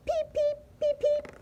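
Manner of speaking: very high tiny peeping; four short squeaky peeps; flea peeps. A narrator's reading. Narrator voicing a flea suitor, tiny high peeps. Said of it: "Not flea".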